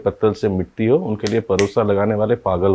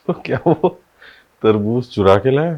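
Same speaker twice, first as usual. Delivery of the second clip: raised, louder disbelieving question; suspicious question; 4 semitones higher, 5 dB louder than usual. Acted. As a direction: blurt out the suspicion, pitched up. Narrator voices a suspicious question.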